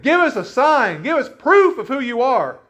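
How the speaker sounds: high, loud crowd demand; insistent demand. Acted. Crowd confronts Jesus wanting proof, loud insistent demand.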